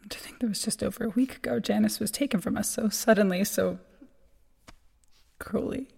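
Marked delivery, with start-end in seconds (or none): 0.1-1.6 hushed, grieving whisper. Acted. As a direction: breathe it out softly. Eulogy at a funeral.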